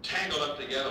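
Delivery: usual speaking voice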